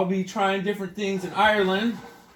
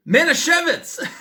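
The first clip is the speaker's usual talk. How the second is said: laughing; 10 semitones higher, 4 dB louder than usual. Real, not acted. Laughing at comparing wine.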